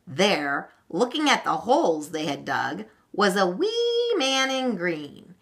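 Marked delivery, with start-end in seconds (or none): none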